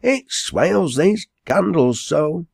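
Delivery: high, slow dialect complaint; complaining landlady. Acted. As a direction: fret aloud over the draught. Landlady complains about open door.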